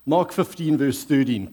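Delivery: ordinary delivery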